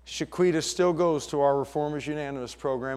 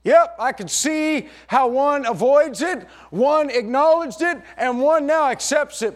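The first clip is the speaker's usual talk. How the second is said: loud, high, rhythmic preacher's build; preaching at full force; 9 semitones higher, 7 dB louder than usual. Acted. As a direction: hammer each clause home to the congregation.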